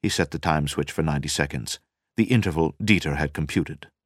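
plain reading voice

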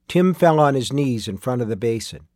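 ordinary delivery, nothing marked

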